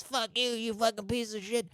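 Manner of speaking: high, softened mock shriek; spoilt brat. Bride cursing the pleading gangster, high mock tantrum. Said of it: "kicking off like a sort of spoilt brat".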